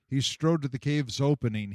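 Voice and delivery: ordinary delivery, nothing marked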